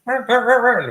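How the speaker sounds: high, loud rapid squawking; panicked chicken. Retold or imitated. Voicing the panicked chicken running off.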